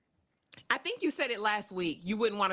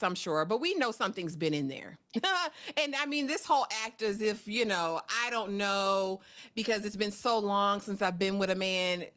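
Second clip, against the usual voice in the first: usual speaking voice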